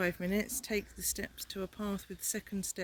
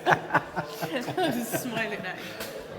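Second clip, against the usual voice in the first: laughing; 3 semitones higher, 5 dB louder, much slower than usual. Real, not acted. Laughing while explaining the photo.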